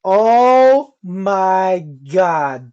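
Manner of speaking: loud, very slow, drawn-out groan; slow, booming exasperation; dramatic dismay. Real, not acted. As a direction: stretch every word out in disbelief. Reacting to the cashier reveal.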